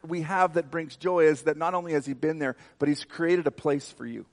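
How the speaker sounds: loud, high, driving preacher voice; preaching at full force. Acted. Preacher rousing the congregation about hope, loud and high.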